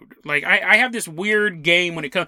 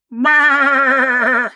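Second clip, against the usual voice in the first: long, louder, held goat bleat; 4 semitones higher, 5 dB louder than usual. Shown as a demonstration. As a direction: imitate a goat with one sustained bleat. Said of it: "is that how a goat sounds".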